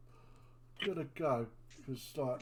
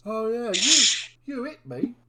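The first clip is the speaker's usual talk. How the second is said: whiny big lug; 9 semitones higher, 8 dB louder than usual. Acted. Bert hurt, complaining.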